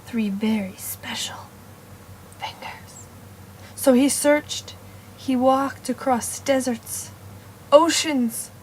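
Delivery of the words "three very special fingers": hushed, secretive whisper. Acted. Teller whispering about the special fingers. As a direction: let it drop to a secret.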